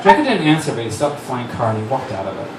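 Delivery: ordinary talk, nothing marked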